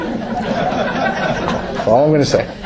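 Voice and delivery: slow, breathy laughing trail-off, mostly unvoiced; stifled laugh. Real, not acted. Teacher to class, cutting off the topic with a stifled laugh. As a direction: swallow a laugh as you cut yourself off. Teacher stops short of a joke.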